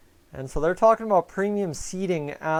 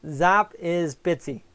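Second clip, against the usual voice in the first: Zap is Bitsy said slowly, each word spaced; slow, deliberate; much slower than usual. Shown as a demonstration. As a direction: spell out the names slowly and clearly. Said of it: "There's two names for her".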